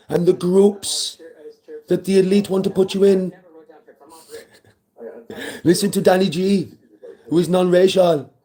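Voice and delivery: put-on speechifying voice, drawn out; mock preaching. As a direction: preach grandly to a friend. Mock sermon of advice.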